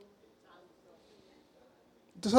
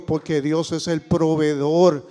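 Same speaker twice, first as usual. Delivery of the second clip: normal talking voice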